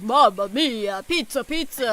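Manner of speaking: hammed-up Italian pizza cliché; jolly italian pizza chef. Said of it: "walk around Italy like".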